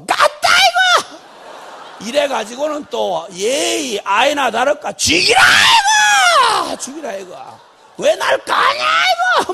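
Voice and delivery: loud, high, wailing rant; angry outburst. Retold or imitated. Jonah ranting loudly at God over the vine. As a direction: rage and wail at God. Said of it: "여호와가".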